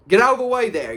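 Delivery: high, loud irritable order; snarl. A narrator's reading. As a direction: snarl at someone blocking you. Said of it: "he snarled at Ron".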